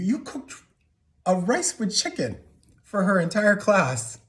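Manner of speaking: jealous hurt bursting out, high and emphatic; incredulous outrage. Acted. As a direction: spell out the injustice in disbelief. Incredulous at father's kindness to sister.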